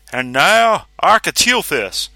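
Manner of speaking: high, loud, slow showman's announce; grand announcement. A narrator's reading. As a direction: roll out the name like a ringmaster. Ringmaster announces the beast.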